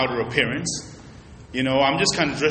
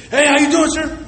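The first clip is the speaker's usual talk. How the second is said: higher, eager salesman hello; really excited greeting; 10 semitones higher than usual. Retold or imitated. Pastor imitating the young salesman greeting another buyer, excited. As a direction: greet him like he's the big customer. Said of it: "Really, really excited".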